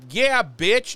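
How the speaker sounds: high, loud gloating jeer; mock gloating taunt. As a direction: rub it in like a triumphant taunt. Buffy gloating through the door.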